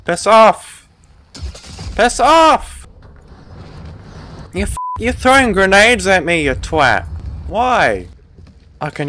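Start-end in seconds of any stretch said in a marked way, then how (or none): 0.1-0.9 loud, high irritated shout; real exasperated yell; angry shout. Real, not acted. Second escalating repeat under attack. 1.9-2.8 high, loud exasperated yell; real high-pitched outburst; angry yell. Real, not acted. Third escalating repeat under attack. 4.5-8.1 loud, high-pitched indignant outburst; exasperated gamer shouting; outraged yell. Real, not acted. Yells at grenade thrower.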